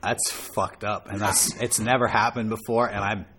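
mock mafia boss. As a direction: growl it low like a crime boss. Plays angry record-label boss.

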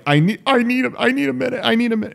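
high, tearful, mock-distraught actress; Sansa crying. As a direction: beg for a minute, half-sobbing. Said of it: "she, like".

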